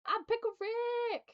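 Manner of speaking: high, slow cartoon yell; Pickle Rick. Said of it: "I know of Pickle Rick".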